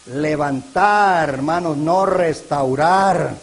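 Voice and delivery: "levantar" drawn out very slowly; slow emphatic contrast. Shown as a demonstration. The preacher contrasting the prophecy's words for the congregation, slow and stressed. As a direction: stretch "levantar" out to set it against "restaurar". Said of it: "La profecía decía".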